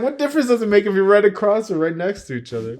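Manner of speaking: high, loud, rushed rhetorical question; retold exasperated challenge. Challenging gym buddy about couples sitting together, exasperated protest.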